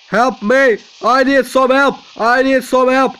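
loud, high distress call; mock cries for help. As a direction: call out for rescue, hammed-up. Calling for help in game.

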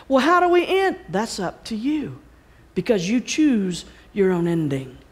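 ordinary delivery

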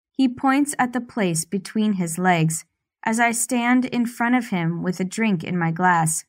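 ordinary delivery

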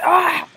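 sudden loud, high shout; imitated scream. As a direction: burst out a mock jumpscare "ah". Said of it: "He's like".

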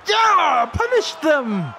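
high, loud, drawn-out cheer; triumphant shout. Real, not acted. Scoring after stealing the ball.

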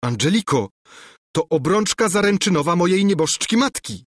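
a prince pleading over his dead mother's ring; crying out. A narrator's reading. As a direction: cry out in dismay. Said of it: "krzyknął Lulejka".

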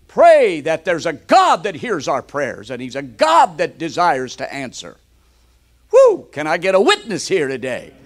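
loud fervent preaching; preaching at full force. Real, not acted. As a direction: drive it home to the congregation.

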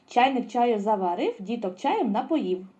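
the tongue twister picked up in speed. Shown as a demonstration. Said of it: "А тепер швидше".

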